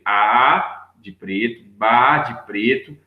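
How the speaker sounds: slow, pointing out each syllable; walk the class through it slowly; slow, careful teacher voice; slow syllable demo. Shown as a demonstration. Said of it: "Olha pra você ver".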